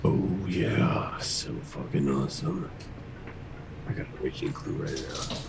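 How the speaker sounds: whispering. Real, not acted. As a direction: whisper it like a secret. Whispering about drink.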